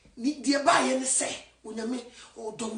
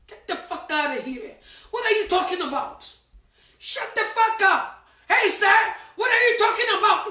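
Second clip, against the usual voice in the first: loud mocking yell at the preacher; angry crowd yelling; 5 semitones higher, 6 dB louder than usual. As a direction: shout him down, outraged. Crowd shouting at evangelist.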